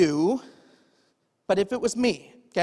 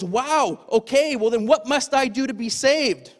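amazed jailer; 5 semitones higher, 5 dB louder than usual. Acted. Acted reaction of shaken jailer.

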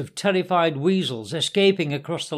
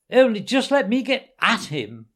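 seething Toad, raised pitch; angry muttering; 5 semitones higher, 4 dB louder than usual. A narrator's reading. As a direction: strain to get at him through gritted teeth. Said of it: "muttered Toad, grinding his teeth".

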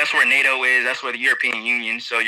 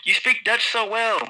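impressed local; 8 semitones higher than usual. Retold or imitated. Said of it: "They'd be like".

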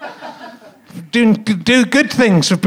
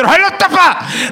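put-on Belfast accent; 9 semitones higher than usual. Comic copying Belfast kids' name for show, Belfast accent. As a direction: call it out in a hammed-up Belfast accent. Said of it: "That was a Belfast accent there".

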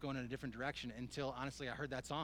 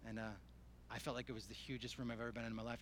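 ordinary talk, nothing marked

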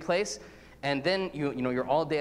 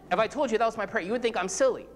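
normal talking voice

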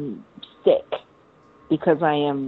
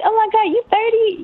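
high-pitched mock astonishment; squealed disbelief, played for laughs; amazed reaction. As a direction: imitate someone stunned, voice shooting way up. Voices amazed people reacting to age.

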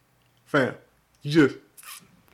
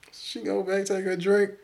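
normal talking voice

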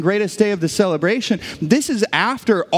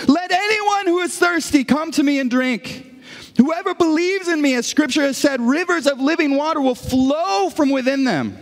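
high-pitched proclamation; crying out; 8 semitones higher than usual. Retold or imitated. Jesus calling to a celebrating crowd, crying out. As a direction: call it out high over a partying crowd. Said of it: "crying out to God in a loud voice".

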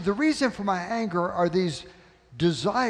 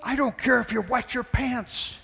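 higher, fed-up retort; mock exasperated father; 7 semitones higher than usual. Father dismissing son's excuse, higher and exasperated. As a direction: snap back at the kid in mock anger.

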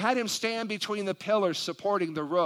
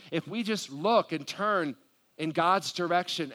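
ordinary delivery

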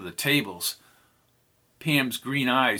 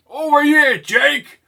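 high, loud call across the room; 10 dB louder than usual. Acted. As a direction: shout over the crowd. Calling across a crowded bar.

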